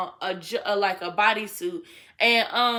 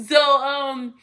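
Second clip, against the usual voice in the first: real laughing; 2 semitones higher, 6 dB louder than usual. Real, not acted. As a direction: try to resume while cracking up. Cracks up at her own story.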